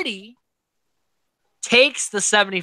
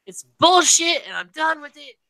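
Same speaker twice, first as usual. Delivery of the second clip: high, disgusted outburst; fed-up rant; 10 semitones higher than usual. Retold or imitated. Host mocking a fed-up houseguest, high disgusted outburst. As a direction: spit it out like he's done. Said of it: "He's like".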